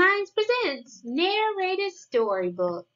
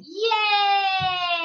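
excited cheer; 4 dB louder than usual. Real, not acted. Cheering at story's end.

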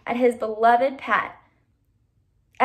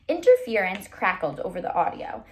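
ordinary delivery

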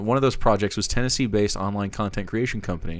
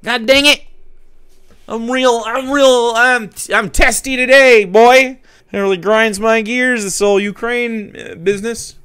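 higher, louder hammed-up cranky old-timer; mock folksy angry pundit; 10 semitones higher, 6 dB louder than usual. Mocking the pundit's staged anger with a folksy twang. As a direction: overplay a testy cowboy grumble. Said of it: "He's like".

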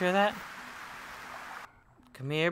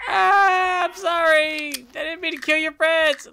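high, loud, crying-out apology; frantic apology; 9 dB louder than usual. Real, not acted. Player wailing a panicked apology to the birds, high and loud.

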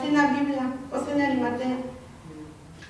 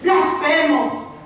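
mob shout; 5 semitones higher, 8 dB louder than usual. Retold or imitated. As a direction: shout it like the jeering crowd. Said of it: "Unos gritando". (grito de la multitud)

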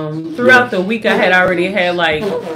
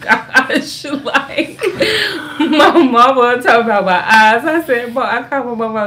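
laughing; 4 semitones higher than usual. Real, not acted. Laughing while retelling her worry.

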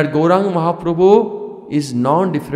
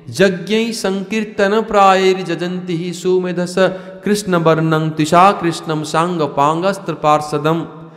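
reciting a Sanskrit verse; 5 semitones higher than usual. Acted.

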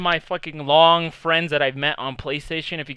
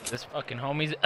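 ordinary talk, nothing marked

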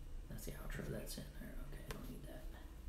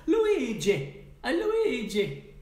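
a high, loud called greeting; calling out; 10 semitones higher, 7 dB louder, much slower than usual. Real, not acted. Greeting a viewer in chat, called out high and loud. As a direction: sing the name out like hailing a friend.

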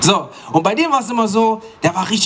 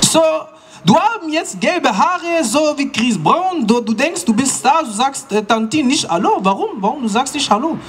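mother's voice; 8 semitones higher than usual. Retold or imitated. Mum scolds son about blond hair and greetings. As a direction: scold him for not greeting auntie.

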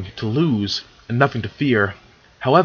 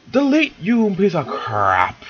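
mock exasperation; 7 semitones higher, slower than usual. Real, not acted. Cursing at the formatting.

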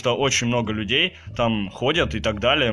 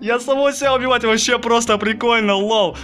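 gleeful; 10 semitones higher, 4 dB louder than usual. Real, not acted. Gleefully killing his namesake.

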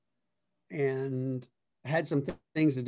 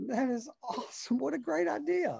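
much higher, eager retold praise; overdone enthusiasm; 11 semitones higher than usual. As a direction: pump it up like a delighted fan. Retelling his reply to the old man.

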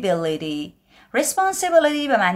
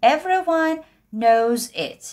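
higher put-on voice; knowing voice; 9 semitones higher, slower than usual. Teacher voicing someone stating a known fact, high and sure. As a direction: say it like it's obvious.

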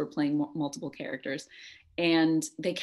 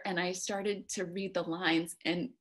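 ordinary talk

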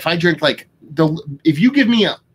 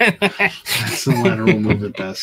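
chuckling through a slower repeat; laughing; 3 semitones lower, slower than usual. Real, not acted. Repeating his joke to his co-host, laughing. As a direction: let the laugh slow the punchline.

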